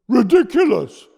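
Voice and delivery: loud, high roar; roared scorn. Acted. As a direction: roar it out in contempt. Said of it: "roared Red Hot Robert".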